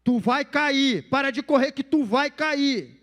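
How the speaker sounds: put-on loud, slow, drawn-out scolding of a child; scolding yell. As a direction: snap at a child who keeps running. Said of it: "Até na hora de repreender o filho".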